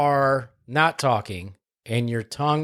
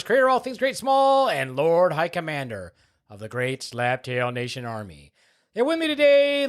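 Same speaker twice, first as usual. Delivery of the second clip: big announcer voice, pitched high; booming podcast host introduction; hype show intro; 3 semitones higher than usual. Acted. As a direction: roll out the grand welcome like a showman.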